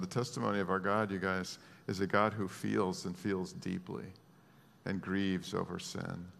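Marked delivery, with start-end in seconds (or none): none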